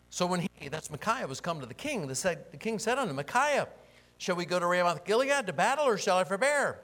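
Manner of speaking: high, loud dramatic Bible reading; loud scripture reading. Acted. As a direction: proclaim the king's question to the room. Reads scripture aloud at full force.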